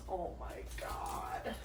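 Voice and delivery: slow, breathy whisper; awed whisper. Real, not acted. Vlogger gazes at the finished image, whispering in awe. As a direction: whisper it in stunned wonder. Overwhelmed by the canvas.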